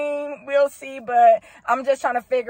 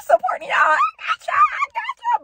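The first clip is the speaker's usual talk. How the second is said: squealing hype. Real, not acted. Fan cheering on the couple with a high squeal.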